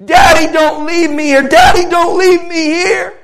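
high, very loud terrified child's cry, imitated; screaming plea. Little girl in a cage begs Daddy, screaming plea. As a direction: scream for help in desperate fear. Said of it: "she started screaming".

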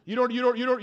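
ordinary talk, nothing marked

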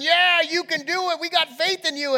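high, loud imitation of a cheering crowd; acted hollering support. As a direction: cheer him on like thousands of fans. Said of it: "Thousands of people were cheering".

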